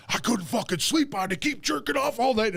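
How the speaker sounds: high-pitched exasperated rant; imitating livid complaint. Friend retells Glenn griping about no sleep, mock livid. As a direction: act out outraged whining about the night. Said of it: "he's fucking livid mad at me".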